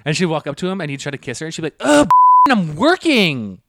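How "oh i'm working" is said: high, loud, drawn-out exasperated snap; retold nagging. Girlfriend fending off a kiss at work, exasperated nagging. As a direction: whine it loudly in irritation. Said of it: "keep fucking nagging him".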